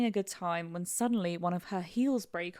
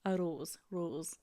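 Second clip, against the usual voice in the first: tentative Scottish "rose", said softly; Scottish accent; 3 semitones lower, 7 dB quieter, much slower than usual. Shown as a demonstration. Struggling with a hard word, tentative Scottish accent. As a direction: try "rose" quietly in Scottish. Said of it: "That's quite a hard word to say in Scotland".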